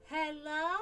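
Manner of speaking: high, meowy Hello?; talking cat imitation. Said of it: "cats that when they meow, it sounds like they're speaking".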